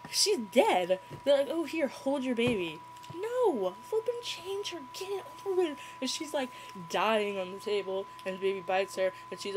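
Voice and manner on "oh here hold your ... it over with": high-pitched mocking chatter; mock hand-off. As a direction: rattle it off in a high, dismissive voice. Mocking characters handing over baby.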